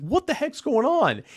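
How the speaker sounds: high-pitched mock bewilderment; startled. High school senior woken by window banging, mock startled. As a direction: act out being woken by banging.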